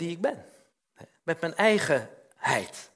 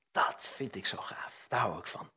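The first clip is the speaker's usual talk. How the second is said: whisper; 7 semitones lower, 8 dB quieter than usual. Real, not acted. Preacher confiding his favourite verse, whispered. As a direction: whisper it like a treasured secret. Delighted aside to the congregation.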